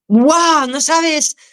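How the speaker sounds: high, loud burst of delight; excited wow. Real, not acted. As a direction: gush with real excitement at the thought. Guest excited about first viewing. (exclamación entusiasmada, aguda y fuerte)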